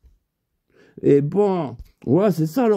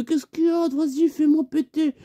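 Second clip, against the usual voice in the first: higher, excited put-on voice; acted intruder; 9 semitones higher than usual. Robber barging in on the neighbours, high-pitched acted demand. Voices the act during the break-in.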